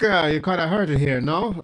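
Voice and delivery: Italian priest imitation. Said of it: "this is like".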